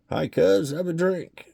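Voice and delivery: put-on a merry drunk welcoming a stranger; tipsy guest. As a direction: drunk man hailing the new cousin.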